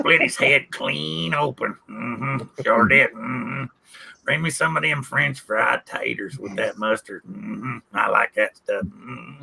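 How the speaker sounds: low, soft grunted hums between lines; Sling Blade impression. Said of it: "doing sling blade".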